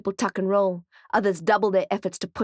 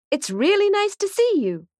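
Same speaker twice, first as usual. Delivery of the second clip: much higher sing-song mascot voice; sugary kids' show host greeting; high cartoon puppy hello; 12 semitones higher, 4 dB louder than usual. A narrator's reading. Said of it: "robot mascot greeting crowd".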